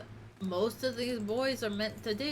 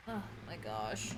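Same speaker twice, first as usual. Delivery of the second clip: exasperated; 12 semitones lower, 8 dB quieter than usual. Real, not acted. Exasperated sigh.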